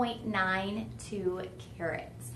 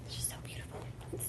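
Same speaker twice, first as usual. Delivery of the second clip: whispered awe; 16 dB quieter, much faster than usual. Real, not acted. Host admiring ring up close.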